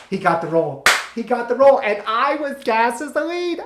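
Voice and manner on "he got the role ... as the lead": gleeful crowing. Real, not acted. Teller revealing he got the lead, high gleeful crow. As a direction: stretch it upward into a gloat. Teller crows over his scheme.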